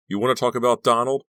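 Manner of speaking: desperation. A narrator's reading. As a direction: fling the question, at the end of his rope. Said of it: "Kerry's voice was filled with desperation".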